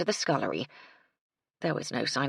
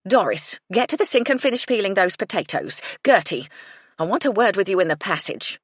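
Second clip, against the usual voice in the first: stern orders; 4 semitones higher, 6 dB louder than usual. A narrator's reading. Housekeeper giving orders.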